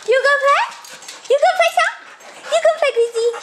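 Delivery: high-pitched coaxing to a dog; sing-song invitation to play; high coaxing dog talk. Real, not acted.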